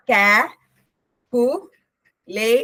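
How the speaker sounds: "കാ, കു, ലേ" as three separate beats; teacher breaking the word into "കാ, കു, ലേ"; syllable by syllable. Shown as a demonstration. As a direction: leave long pauses between "കാ", "കു" and "ലേ". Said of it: "It has three vowel sound".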